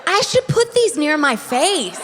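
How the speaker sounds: high, curious little "huh"; a child struck by a silly idea; mock child musing. Imagining herself as a child, high mock wonder. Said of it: "think to myself".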